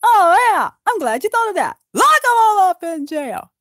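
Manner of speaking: high, loud gloating elf king; gleeful elf king. Acted. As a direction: crow over locking them up. Thranduil voiced gleefully agreeing.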